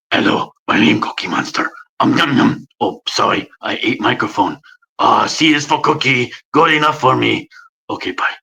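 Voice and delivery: low, rasped monster growl; Cookie Monster. Shown as a demonstration. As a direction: scrape it from the throat like Cookie Monster. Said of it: "Cookie Monster's very low as well".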